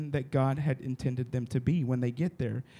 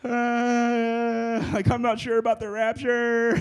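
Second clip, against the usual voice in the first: higher, unsure, jittery voice; nervous flyer; 7 semitones higher, 4 dB louder, slower than usual. Retold or imitated. Pastor retelling nervous moment boarding plane, jittery. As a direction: sound scared of the plane. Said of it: "I was like".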